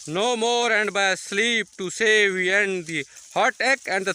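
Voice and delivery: very slow, drawn-out declamation; slow, measured verse recital with long held words; dramatic soliloquy recitation. Acted. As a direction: declaim it like Shakespeare on stage, stretching each phrase. Recites Hamlet's famous soliloquy.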